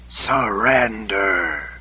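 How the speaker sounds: very slow, drawn-out otherworldly command; eerie voice. A narrator's reading. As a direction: stretch it out like a haunting voice in the head. Voice in her head commands.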